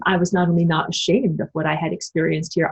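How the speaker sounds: usual speaking voice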